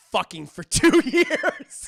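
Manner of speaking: loud confession cracking into laughter; breaking into laughter. Real, not acted. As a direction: lose it laughing mid-line.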